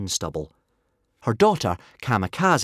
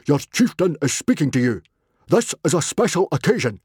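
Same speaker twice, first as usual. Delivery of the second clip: raised, authoritative chieftain's voice; roaring chieftain; 6 semitones higher, 4 dB louder than usual. A narrator's reading. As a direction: scold the young man as his chieftain. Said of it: "he roared".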